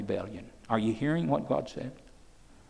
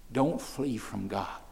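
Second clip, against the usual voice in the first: hushed warning; 3 semitones lower, 5 dB quieter, slower than usual. Acted. Preacher warning congregation softly.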